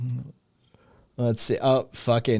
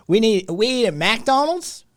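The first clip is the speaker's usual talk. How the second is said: excitable mock Southern accent; hammed-up Southern accent; 11 semitones higher, 4 dB louder than usual. Hammed-up Southern fast food bit.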